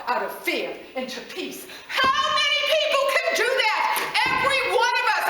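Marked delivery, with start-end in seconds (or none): 1.9-5.2 high, loud, almost singing out to the room; passionate yell. Real, not acted. Preacher to congregation, crying out passionately. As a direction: cry it out with full conviction. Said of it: "I'm not yelling out like, I'm passionate".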